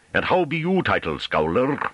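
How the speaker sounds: high-pitched country jeer; taunting rustic farmer. A narrator's reading. Farmer demanding the scholar's name, taunting. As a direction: ask it mockingly in broad dialect.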